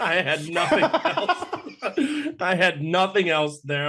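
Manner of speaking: helpless laughter through the words; laughing. Real, not acted. Guest admitting to the host the joke was empty, laughing.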